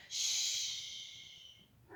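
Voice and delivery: unvoiced hush; whispered shush. Reading a secret book aloud, whispered shush.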